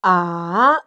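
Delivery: "A" dipping down then up; a low U-turn "A", falling then rising; third tone demo. Shown as a demonstration. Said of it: "is the third tone".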